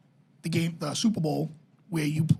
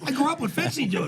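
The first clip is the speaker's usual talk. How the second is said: mock fan boast; 7 semitones higher than usual. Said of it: "mock fan boast".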